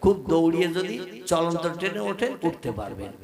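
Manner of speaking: loud, driving sermon voice; preaching. Acted. Preacher describing the train journey to listeners, loud preaching. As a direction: preach the story out to the hall.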